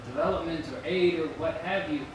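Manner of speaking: usual speaking voice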